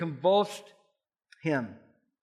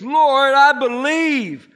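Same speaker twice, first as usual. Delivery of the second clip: loud, high, drawn-out cry to God; 6 semitones higher, 10 dB louder, much slower than usual. A narrator's reading. Father of the sick child cries out to Jesus, loudly. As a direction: cry it out in desperate faith, without sobbing. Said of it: "cried out and said with tears".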